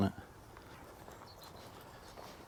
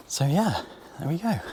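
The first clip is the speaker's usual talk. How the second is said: high, lively sign-off, much higher; bright sign-off; 9 semitones higher than usual. Real, not acted. Closing the sunflower farm visit, cheery higher sign-off. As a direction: wrap it up with a cheery lift. Wrapping up first stop.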